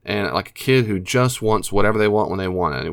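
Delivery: usual speaking voice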